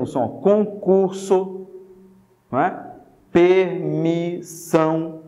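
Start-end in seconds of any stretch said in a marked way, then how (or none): 0.3-1.7 "concurso" slowly, syllable by syllable, clear S; demonstrating S sound. Shown as a demonstration. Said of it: "Você ouve, por exemplo". 3.3-5.2 "Permissão" very slow, syllable by syllable; demonstrating S sound. Shown as a demonstration. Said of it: "você ouve esse som de S".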